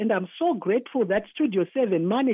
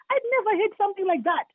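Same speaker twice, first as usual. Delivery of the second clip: shocked outburst; 9 semitones higher than usual. Real, not acted. Shocked by trafficking news.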